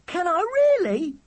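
put-on gasp, then very high amazed child; thrilled. Charlie offered William's Instamatic, thrilled. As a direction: squeal it with breathless delight.

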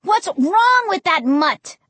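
high, exasperated outburst; crying out. A narrator's reading. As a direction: cry it out in frustration. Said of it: "I cried".

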